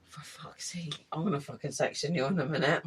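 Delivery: hushed muttered threat; playful hushed threat; exasperated whisper. Real, not acted. Mock threat to friend.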